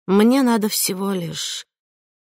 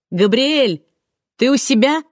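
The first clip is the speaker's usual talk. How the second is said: calling out; 7 semitones higher, 5 dB louder than usual. A narrator's reading. Calling out to Gabriel upstairs.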